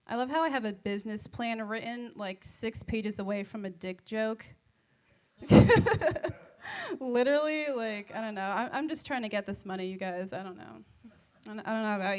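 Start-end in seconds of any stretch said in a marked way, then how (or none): 5.4-6.4 loud, high-pitched laugh; real burst of laughter. Real, not acted. Comic cracking up at her own dick joke, laughing loudly.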